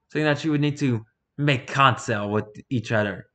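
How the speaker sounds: normal talking voice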